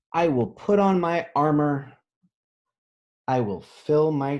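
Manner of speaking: bold declaration. A narrator's reading. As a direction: announce it like a young knight. Book line read boldly.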